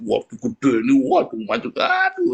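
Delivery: rising hammed-up alarm, ending in a high squeal; mock dismay. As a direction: gasp at a botched face, playing it up. Mocking a cosmetic surgery result.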